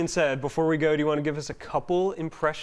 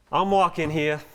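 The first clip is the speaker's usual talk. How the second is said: New York accent. Shown as a demonstration. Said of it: "let's see you do that".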